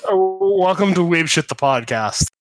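normal talking voice

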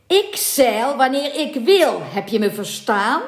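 furious captain's shout; angry captain. A narrator's reading. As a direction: hammer it home to silence him.

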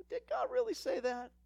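high, sly tempter's question; tempting voice. Retold or imitated. Preacher voicing the serpent's tempting question, high and sly. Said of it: "that voice that says".

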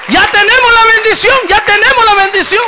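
very high, full-force preacher's shout; shouted proclamation. Real, not acted. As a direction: declare it triumphantly to the whole church. Clímax del sermón, proclama repetida. (proclamación triunfal a gritos)

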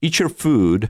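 "food" held long with final D; long, voiced ending. Shown as a demonstration. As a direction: stretch "food" in the phrase. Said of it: "Jedno je delší a obsahuje Dčko". (dlouhé „food“ na konci věty)

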